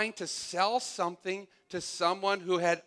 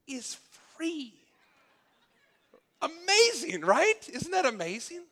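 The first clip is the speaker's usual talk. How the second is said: much higher and louder, hammed-up infomercial gush; mock amazement; 11 semitones higher, 5 dB louder than usual. Preacher parodying QVC hosts, hammed-up high excited gush. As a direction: squeal it like a TV seller faking awe. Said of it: "this is all sarcastic".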